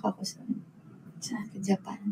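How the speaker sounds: breathy trailing whisper. Real, not acted. Admitting she cried in Japan, whispered. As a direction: let the place fade into a whisper.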